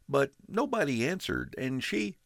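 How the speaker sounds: put-on high young boy's voice, a little shaky; nervous kid apologizing at the door; boy, voice shaking a little. A narrator's reading. Boy pleading with father.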